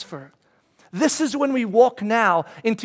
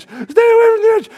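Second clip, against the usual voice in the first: panicked shout; 14 semitones higher, 10 dB louder than usual. Retold or imitated. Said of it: "I'm like".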